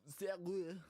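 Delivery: mock French pronunciation; French accent. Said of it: "as you trying to speak French".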